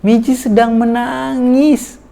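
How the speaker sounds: high, slow, soothing naming of feelings; calmly name what the child feels; gentle labeling. Shown as a demonstration. Parent gently naming the crying child's feeling, high and slow. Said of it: "terus sambil ekspresi kita".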